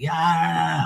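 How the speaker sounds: high, drawn-out goofy yell; crazy yell. Retold or imitated. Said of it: "I act crazy and stuff".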